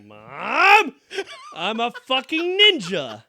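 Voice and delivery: high, loud, drawn-out boast; gleeful kid yell. Retold or imitated. Kid showing Mom his nunchuck, gleeful yell. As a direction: show off loudly to Mom.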